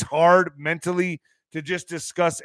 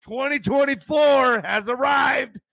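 high, slow proclaimed shout; grand announcement; 9 semitones higher, slower than usual. Acted. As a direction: proclaim it to the crowd. Announcing the new year.

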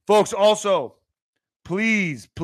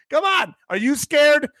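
high mock officer; 8 semitones higher than usual.

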